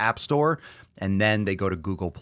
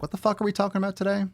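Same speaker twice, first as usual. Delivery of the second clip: frantic bewilderment; 12 semitones higher, much faster than usual. Retold or imitated. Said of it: "I'm like".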